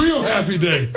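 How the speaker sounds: usual speaking voice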